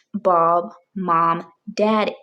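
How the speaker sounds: ordinary delivery, nothing marked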